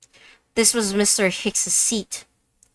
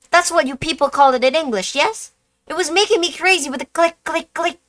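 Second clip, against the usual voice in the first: high, loud, rapid fussy witness voice; excitable witness; 11 semitones higher, 8 dB louder than usual. Acted. Witness insisting to the detective, high and excited. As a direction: blurt it out like an indignant fussy gentleman. LeBlanc role voice in game.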